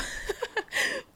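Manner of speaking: high giggle. Real, not acted. Reliving seeing [name] up close.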